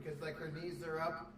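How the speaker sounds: ordinary delivery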